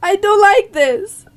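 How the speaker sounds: high, loud scared whine; panicked, near tears. Real, not acted. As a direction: wail it, half laughing with fright. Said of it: "I'm going to cry".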